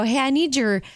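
usual speaking voice

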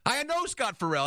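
squeaky high yes, then eager; imitated excitement. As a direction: mimic him leaping at the name. Quoting the guy's excited confirmation.

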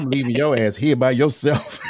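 laughing through the line; words bubbling with real laughter. Real, not acted. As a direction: chuckle it out while joking. Joking about wife finding phone.

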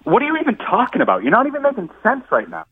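high exasperated disbelief; baffled and fed up. Real, not acted. Baffled, angry at prank caller.

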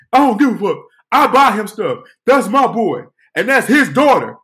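retold defiant yelling; acted-out angry arguing. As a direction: argue back loudly, staking your claim. Man insists in shouted quarrel.

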